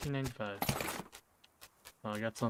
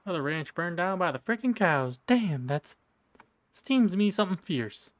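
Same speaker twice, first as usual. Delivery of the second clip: cowboy voice; 6 semitones higher, 4 dB louder than usual. Acted. Reading a rancher's angry note.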